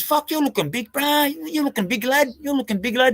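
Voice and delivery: mock of a rival eyeing a bigger man; imitating Sowens. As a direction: ham up his awe at the size. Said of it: "he goes".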